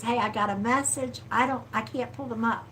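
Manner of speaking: ordinary talk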